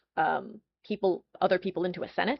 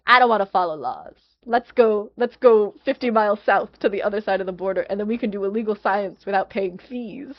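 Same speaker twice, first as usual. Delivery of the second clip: louder, cocky rule-dodging company boss; brash rule-breaker; 6 dB louder than usual. Retold or imitated. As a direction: play a swaggering outlaw scientist.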